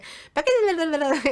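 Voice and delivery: put-on high, playful baby gibberish; imitating toddler babble. Mother showing how her daughter babbled, high and playful. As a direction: copy the little girl's nonsense chatter. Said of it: "sabían que ella hacía".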